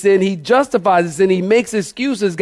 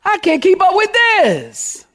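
very high, drawn-out protest; slow high-pitched preacher's wail; exasperated cry; 14 semitones higher, slower than usual. Real, not acted. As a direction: stretch it out high, dropping at the end.

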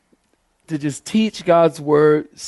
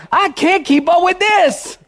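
very high-pitched preacher's protest; high wailing complaint; exasperated cry; 12 semitones higher than usual. Real, not acted. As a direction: throw your voice way up in exasperation. Preacher cries out his exasperation.

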